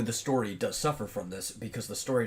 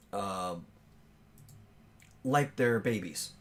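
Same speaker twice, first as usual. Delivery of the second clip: long pauses between short, emphatic phrases; halting pauses; 3 dB louder than usual. Real, not acted. Podcast host working out his argument aloud, halting with long pauses. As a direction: stop and start, thinking it out as you go. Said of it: "sounds like with those pauses".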